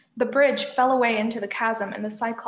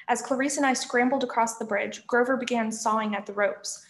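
ordinary delivery, nothing marked

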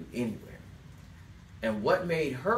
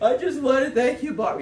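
higher, louder mock tearful thanks with a laugh; mock sobbing; 13 semitones higher, 8 dB louder than usual. Celebrity thanking the interviewer after the interview, mock sobbing. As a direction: ham up a guest blubbering gratitude. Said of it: "it's a guy sobbing wet".

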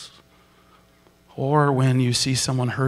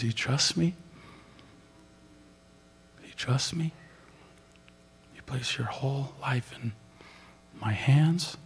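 gentle question; 9 dB quieter than usual. Retold or imitated. Jesus voiced gently asking.

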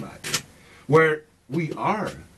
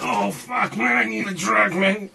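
mock addict; 5 semitones higher, slower than usual. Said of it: "if you don't have it".

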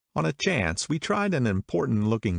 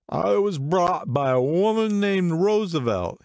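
much higher, louder, slow tipsy chatter; excited drunk man; 11 semitones higher, 4 dB louder than usual. A narrator's reading. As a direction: prattle proudly like a drunk.

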